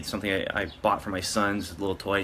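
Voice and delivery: ordinary talk, nothing marked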